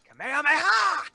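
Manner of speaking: high battle cry; anime attack shout. Pretend. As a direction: yell the attack name.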